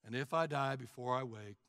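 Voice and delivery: low, soft monotone bedtime prayer; no emotion. Acted. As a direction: drone it out, tailing off toward bed. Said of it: "There's no emotion".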